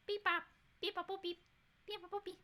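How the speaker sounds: high beep-boop computer noises; thinking noise. Real, not acted. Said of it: "that's my noise when I'm thinking".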